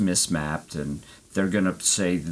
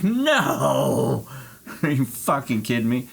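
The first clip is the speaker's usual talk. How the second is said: mock furious; 6 semitones higher, slower than usual. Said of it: "And I was furious".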